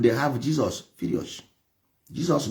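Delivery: ordinary talk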